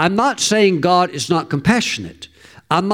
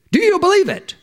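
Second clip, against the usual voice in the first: very high, insistent question; urgent challenge; faster than usual. Acted. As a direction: push the question hard, voice leaping up. Pressing God's question on congregation.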